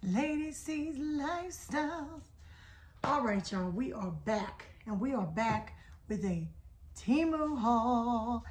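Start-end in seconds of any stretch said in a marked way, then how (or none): 0.0-2.2 show intro. Acted. Channel name drawn out as intro. 7.0-8.4 slow announcement. Acted. Drawn-out title announcement.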